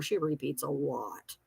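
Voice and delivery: muttered aside. Real, not acted. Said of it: "She's a repeater".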